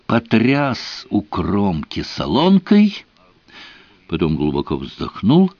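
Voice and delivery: usual speaking voice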